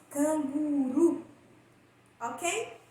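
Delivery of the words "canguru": canguru slowly, the last syllable ru pushed; stressed final syllable. Shown as a demonstration. Teacher modelling oxytone canguru for students. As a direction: lean hard on ru in canguru. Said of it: "a gente dá uma força".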